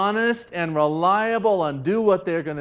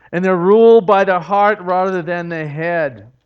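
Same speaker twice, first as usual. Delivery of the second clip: usual speaking voice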